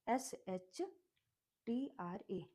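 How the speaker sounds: normal talking voice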